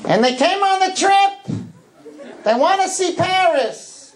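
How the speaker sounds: put-on very high-pitched old-lady caricature; old grandmothers. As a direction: mimic American grannies way up in falsetto. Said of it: "Alta Babas mean old grandmothers".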